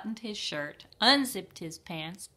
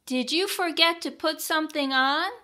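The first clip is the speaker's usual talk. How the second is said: raised voice; 9 semitones higher, 6 dB louder than usual. A narrator's reading. Mother asks from doorway.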